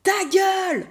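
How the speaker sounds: high, loud yell; retold furious shout from the coach-like neighbour. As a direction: bark it across the gym. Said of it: "il me crie".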